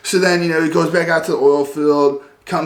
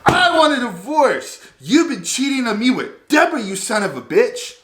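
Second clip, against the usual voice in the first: shrill, much higher mock outburst of a furious wife; flipping out; 11 semitones higher than usual. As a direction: imitate the wife losing it, voice shooting up. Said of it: "And she flips out".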